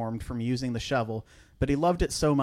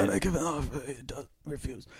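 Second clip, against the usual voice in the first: quiet, softer mock Godfather mumble; Vito Corleone impression; 7 dB quieter than usual. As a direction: mumble it like a tired old mob boss. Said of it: "Vito Corleone".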